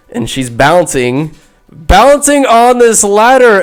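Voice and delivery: ordinary talk, nothing marked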